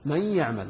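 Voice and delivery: "من يعمل" joined, the ن melting into a doubled ي; nun assimilated. Shown as a demonstration. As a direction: say "من يعمل" as mayya'mal. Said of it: "تدغم النون في الياء فنقرأها".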